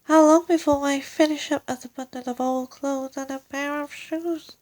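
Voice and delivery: high, quick, fretful wife's voice; fretful old wife. Acted. Mrs Twit frets to her husband, high and quick. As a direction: rush it out like a frightened old woman.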